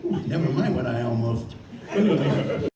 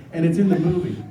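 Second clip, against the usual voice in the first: ordinary talk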